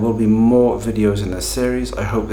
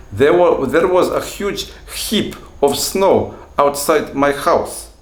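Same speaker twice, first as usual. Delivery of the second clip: put-on slow, higher, louder Russian-accented English; 6 semitones higher, 4 dB louder, slower than usual. Teacher acting what his student might have said, Russian accent. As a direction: play a Russian learner, pitched up and deliberate. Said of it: "when you listen to Russian speakers speaking English".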